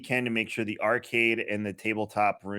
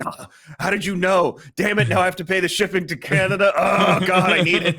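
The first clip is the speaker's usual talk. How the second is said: high, loud imitated wailing complaint; hammed-up anguish, building to a groan; mock dismay; 11 semitones higher, 9 dB louder than usual. Customer back from Jamaica learns of coffee release, imitated loud anguish.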